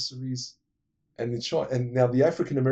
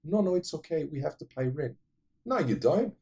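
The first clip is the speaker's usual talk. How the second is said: higher, lightly voiced young woman asking; shop girl voice; 6 semitones higher than usual. Vlogger retelling the film, girl questions Van Damme, higher voice. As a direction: pitch it up as the girl.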